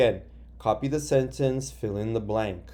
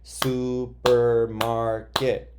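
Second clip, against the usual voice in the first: Supermarket drawn out slowly, syllable by syllable; slow sounded out; 4 dB louder, much slower than usual. Shown as a demonstration. Teacher sounds out the word for students, slowly. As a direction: stretch every syllable of Supermarket. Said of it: "You would say, "Supermarket".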